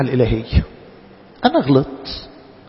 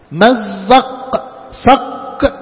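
drawn-out proclamation; 4 semitones higher, much slower than usual. Acted. Dramatic slow proclamation.

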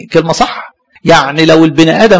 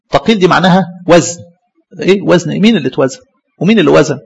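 usual speaking voice

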